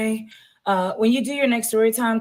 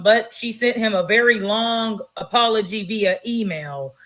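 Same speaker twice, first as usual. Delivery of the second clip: normal talking voice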